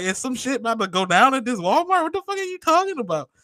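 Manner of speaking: high-pitched, incredulous, rising into a squeak; alarmed disbelief. Retold or imitated. Telling a friend about a strange Walmart cashier, high incredulous squeak. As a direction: act baffled and spooked by the cashier's talk. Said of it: "Like, I'm looking around, like".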